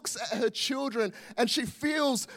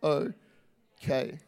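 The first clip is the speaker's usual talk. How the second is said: much softer and lower, gentle hush; tender mother; 7 semitones lower, 6 dB quieter, slower than usual. Pretend. God comforting like a mother, tender hushed reassurance. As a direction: soothe it like a mother cradling a child. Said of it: "with compassion like a mother and says".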